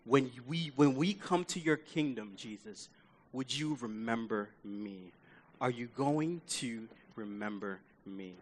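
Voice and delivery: hushed, low, tentative plea; worried. Acted. Dying thief asking Jesus to remember him, softly worried. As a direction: ask it softly, unsure of the answer. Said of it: "he's worried".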